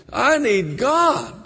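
put-on high, drawn-out slow drunken plea; imitating a drunk. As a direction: beg for God like a drunk man. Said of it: "He was drunk".

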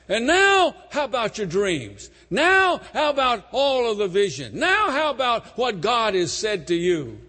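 high, loud fiery preacher cries; preaching at full force. Acted. Preacher shouting to congregation about Joseph's prison, high and loud. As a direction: thunder at the congregation from the pulpit.

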